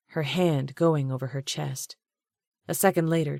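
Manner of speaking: usual speaking voice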